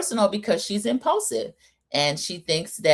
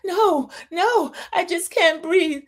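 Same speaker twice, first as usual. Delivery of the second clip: high, drawn-out mock distress; hammed-up panicky refusal, pitched way up; faked panic attack; 12 semitones higher, slower than usual. Recapper mimics Margot refusing the producer's offer, mock panic. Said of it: "Margot was faking that panic attack".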